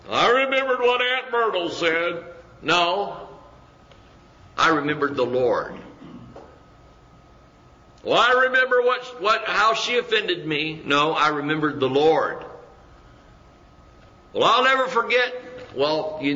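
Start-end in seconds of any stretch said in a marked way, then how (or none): 0.1-2.2 high, whining-style mimicry of a grudge; put-on high voice dwelling on an old slight; mock grudge-holder. Mimicking someone recalling a relative's remark, mock grudge. 8.0-10.8 high-pitched put-on offended voice; mock grudge-holder. Mimicking someone who recalls being offended, mock grudge. 14.3-15.3 high, put-on unforgiving voice; mock grudge-holder. Mimicking someone who will never forget, mock grudge.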